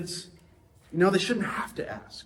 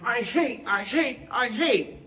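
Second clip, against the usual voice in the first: lightly voiced two-year-old, higher, chanting I hate; a hint of a stubborn toddler, pitched up; toddler saying I hate; 7 semitones higher, slower than usual. A preacher imitates his two-year-old repeating a banned word, mildly childish. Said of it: "going around saying".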